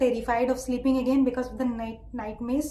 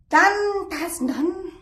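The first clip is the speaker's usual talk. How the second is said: pleased exclamation; 3 semitones higher, much slower than usual. Real, not acted. Celebrating the stolen key.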